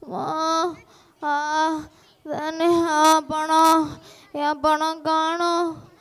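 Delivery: drawn-out groans of a beaten man; moaning in pain. Acted. Injured man left on the road, drawn-out pained moans. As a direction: moan and lament the lost money.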